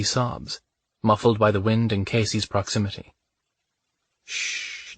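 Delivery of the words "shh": a soft, breathy, voiceless hush; soothing hush. Acted. Man hushes his sobbing lover in a soft voiceless shh. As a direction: hush her gently, air only. Comforting her sobs.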